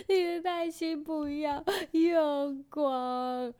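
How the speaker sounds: put-on wailing little-kid plea, drawn out slowly; imitating child crying. Mom mimics her crying son begging, high and wailing. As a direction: sob it like a scared toddler begging. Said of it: "然后就开始爆哭".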